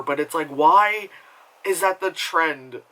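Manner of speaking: normal talking voice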